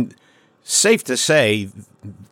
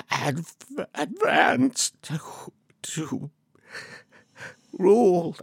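halting creature voice; 7 semitones higher, 6 dB quieter than usual. Acted. Helgrammet answers, shaken.

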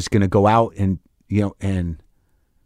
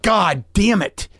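higher, drawn-out mock curse; retold exasperation; 6 semitones higher, much slower than usual. Comic told by producer it's too late, retold exasperation. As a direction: stretch out the frustrated oath without shouting. Retold refusal to cut the joke.

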